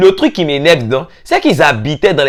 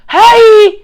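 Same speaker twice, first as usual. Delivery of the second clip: loud high shriek; mock shriek; 6 dB louder than usual. Teller shrieking at the fake name, loud high hi. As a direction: shriek in disbelief.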